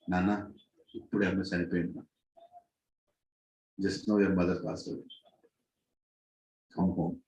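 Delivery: low, soft, subdued father's voice; grave bad news. Father tells son his mother died, grave and hushed. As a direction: break the death gently, hushed and low.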